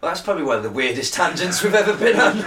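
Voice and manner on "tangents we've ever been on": higher, louder, laughing through the words; real laugh rising as the sentence goes; amused, cracking up towards the end; breaking into laughter. Real, not acted.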